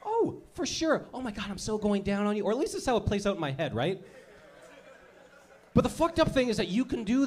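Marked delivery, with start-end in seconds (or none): 0.0-2.4 put-on 1 girly friends. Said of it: "She looks at you like".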